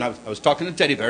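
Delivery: normal talking voice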